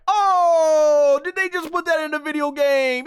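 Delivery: high, loud scandalised disbelief; mock shock. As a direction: squeal it out like you can't believe it. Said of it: "we're just like".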